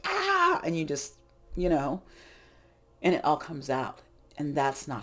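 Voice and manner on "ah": sudden high yelled "Ah!"; snapping at the last straw; angry outburst. Retold or imitated. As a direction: let it burst out all at once. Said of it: "you're like".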